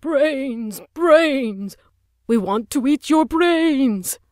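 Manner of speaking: slow, high, loud hammed-up zombie moan; zombie yell. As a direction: play a mock zombie through the door. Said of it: "a guy yelled".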